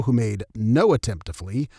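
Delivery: ordinary delivery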